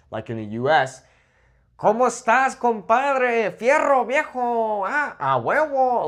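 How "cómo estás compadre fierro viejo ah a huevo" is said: high, sing-song norteño cowboy twang; lilting norteño accent, last words stretched out. Shown as a demonstration. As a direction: do a northern Mexican country accent, pitched way up. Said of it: "we have this more norteño accent".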